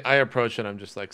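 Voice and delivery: ordinary talk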